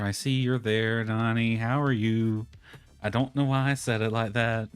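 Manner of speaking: ordinary delivery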